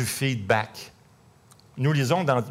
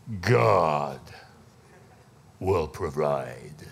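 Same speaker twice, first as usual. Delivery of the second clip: lower, slow and weighty proclamation; deep Jeff voice; 7 semitones lower, 4 dB quieter, much slower than usual. Retold or imitated. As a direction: lay down the verdict like a booming prophet. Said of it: "il a une grosse voix".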